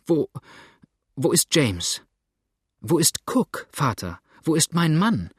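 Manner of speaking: rising, panicked questions; a daughter crying out for her husband; anxious cry. A narrator's reading. Daughter begging her father for news, frantic. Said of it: "rief die Tochter".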